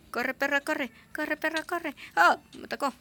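panicked high yelling while fleeing; being chased. Real, not acted.

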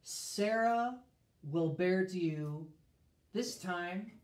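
scripture reading. Acted. Reads God's words in scripture.

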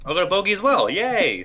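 high, loud happy whoop; excited cheer. Real, not acted. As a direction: cheer it like you just won. Celebrating a tie in the game.

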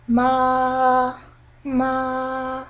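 "Ma" held high and level; high flat tone. Shown as a demonstration. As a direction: sing "Ma" on one straight high note. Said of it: "it's a very high-pitched".

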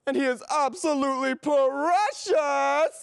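slow, drawn-out adoring boast; doting. Acted. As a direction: stretch out the words with doting pride. Dog owner doting on pet.

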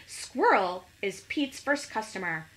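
plain reading voice

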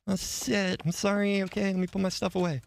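high, sheepish junior worker; hammed-up apologetic squeak; flustered greeting. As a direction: greet the boss in a put-on high voice. Role-plays greeting the boss, late.

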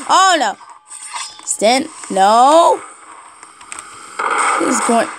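ordinary talk, nothing marked

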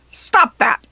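loud, protesting goblin yell. A narrator's reading. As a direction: yell it in indignation. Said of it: "the goblin yelled".

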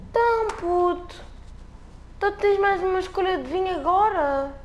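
high, mocking game character voice; high cheeky figure.

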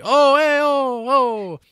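very high, loud amazed gasps; amazed crowd. Retold or imitated. Bystanders after he survives, amazed. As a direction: exclaim in hammed-up wonder. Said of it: "they're like".

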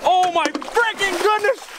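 high-pitched, worked-up outburst over a lost fish; whooping dismay as the fish gets away; frantic exclaiming. Real, not acted. Friend loses a hooked fish.

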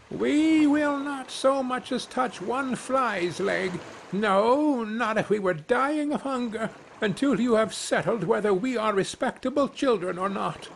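put-on baby birds refusing food in protest, high; indignant young wrens. Young wrens refusing to eat until their honour is settled, high and indignant.

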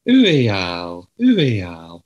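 hammed-up French "Ooh, Al"; playful fake French pun on his name; mock French. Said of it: "which is French for".

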